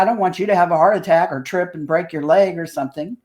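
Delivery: normal talking voice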